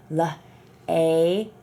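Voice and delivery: slow, letters pulled apart; slow sounding out. Shown as a demonstration. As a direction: sound out each letter slowly. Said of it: "try to say each sound".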